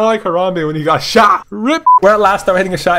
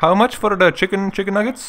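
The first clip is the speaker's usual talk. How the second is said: put-on friendly Indian accent, retroflex t and d; 3 dB quieter than usual. Prank-calling the drive-thru worker in a mock Indian accent.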